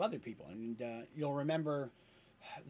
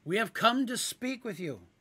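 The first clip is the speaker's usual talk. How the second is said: calling out; 6 semitones higher, 4 dB louder than usual. Acted. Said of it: "cried out Dandy".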